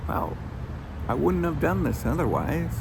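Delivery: ordinary talk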